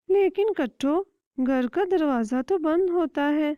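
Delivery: calm your friend down; lightly voiced sparrow.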